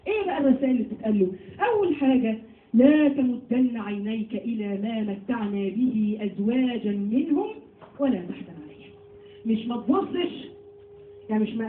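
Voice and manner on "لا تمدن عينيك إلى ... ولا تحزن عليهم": slow measured recitation of the verse; Quran recitation. Acted. Teacher reciting the verse slowly to her students. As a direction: recite it slowly, set apart from the talk.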